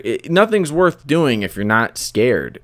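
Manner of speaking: ordinary talk, nothing marked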